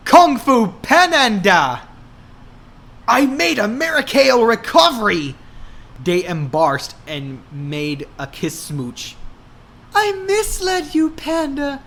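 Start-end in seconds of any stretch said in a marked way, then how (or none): 0.1-5.4 joyful exclaim. Acted. Surprise reunion at door. 10.0-11.8 very high light cooing voice. Acted. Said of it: "Hannah cooned".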